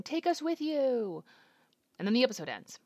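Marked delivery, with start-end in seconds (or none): 0.0-1.2 high, drawn-out pretend yell; mock crowd yell. Crowd calling to the man they adore, mild mock yell. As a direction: imitate a yelling crowd without much volume. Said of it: "people in the crowd are yelling".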